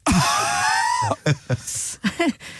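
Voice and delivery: high wheezing honk laugh; imitated wheezy laugh. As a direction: wheeze like an asthmatic emu. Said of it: "which is this".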